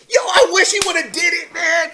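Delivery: high, loud exclamation of gleeful wishing; excited shout. Real, not acted. Excited outburst at imagined scene.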